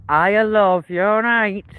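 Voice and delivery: slow, drawn-out mock Manchester accent. Streamer mocking Manchester locals, slow Manchester accent. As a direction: imitate a local Mancunian, slowly. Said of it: "they're all like".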